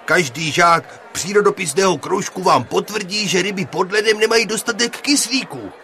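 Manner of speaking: loud, high, pleading teacher's speech. Acted. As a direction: appeal loudly to the room, hesitating at the end. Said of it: "promlouval učitel Pěnička zvýšeným hlasem".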